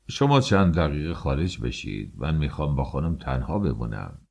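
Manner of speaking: a hint of a spiritual master, pitched low; lightly voiced master. A narrator's reading. Master asks to be alone with the lady, low and even. Master dismisses others from room.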